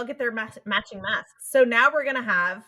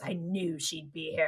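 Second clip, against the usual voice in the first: evil villain; 4 semitones lower, 8 dB quieter, slower than usual. Retold or imitated. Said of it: "he laughs evilly and goes".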